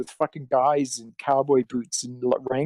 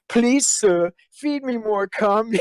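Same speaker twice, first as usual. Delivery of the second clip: higher, slow mock plea; mock pleading; 6 semitones higher, slower than usual. Quoting a fan video to the interviewer, hammed-up pleading. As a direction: beg it in a hammed-up little voice.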